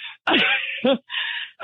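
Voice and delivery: short airy laugh, high and breathless; a dry laugh at her own joke; half-laugh through grief; bitter laugh. Real, not acted. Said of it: "trying to make jokes".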